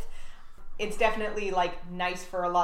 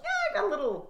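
high-pitched put-on explaining voice; mock casual excuse; 13 semitones higher than usual. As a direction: play it off in a high fake voice. Mock excuse given to Mrs. Roach.

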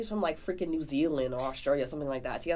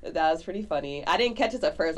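ordinary delivery

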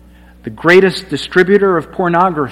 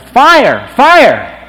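loud, high alarm yell of fire; a passerby shouts a warning at a burning house, high and loud; shouting fire; 9 semitones higher, 10 dB louder than usual. Retold or imitated. Sharp loud alarm call. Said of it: "And he yelled".

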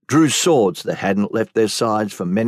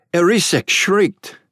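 ordinary delivery

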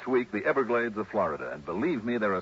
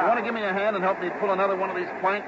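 calling out loud; 12 semitones higher, 5 dB louder than usual. Acted. Calling out over rushing water.

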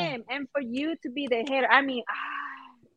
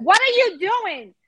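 slow, louder and higher outburst; exasperated yell; 8 semitones higher, 6 dB louder, much slower than usual. Retold or imitated. Fan yelling at a baserunning blunder, slow and loud. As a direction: drag it out, fed up. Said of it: "I was like".

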